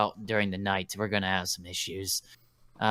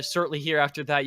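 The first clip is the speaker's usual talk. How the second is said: ordinary talk, nothing marked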